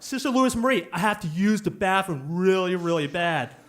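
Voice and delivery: loud plea from a desperate pupil, mildly acted; urgent plea. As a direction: speak up and beg the teacher. Pupil told to speak up pleads.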